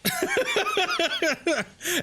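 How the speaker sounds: high-pitched giggling laugh; helpless high laughter. Real, not acted. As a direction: crack up in a high, quick laugh. Laughs at own rant.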